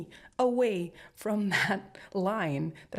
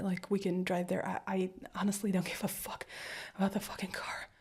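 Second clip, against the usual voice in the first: softer whisper, swallowing anger; whispered coaxing to partner; tense whisper; 5 dB quieter than usual. Acted. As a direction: keep it hushed and steady. Coaxing partner to tell story.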